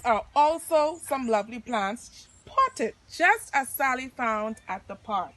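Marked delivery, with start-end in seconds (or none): none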